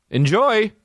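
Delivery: high, sing-song mock cheer; sarcastic. Real, not acted. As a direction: toss it off sarcastically. Sarcastic sign-off.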